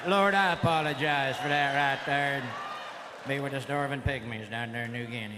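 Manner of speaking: low-pitched, solemn mock prayer; comically pious, dropping to a low amen. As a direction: drop low and pray a jokey apology. Mock prayer repeated after crude joke.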